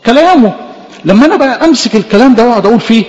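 ordinary talk

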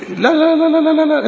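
repeated no, pitched high; high emphatic no. Real, not acted. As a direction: ring out the denials high and insistent. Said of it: "ده إحنا كده مش صلاة". (لا لا لا بصوت عالي الطبقة ورافض)

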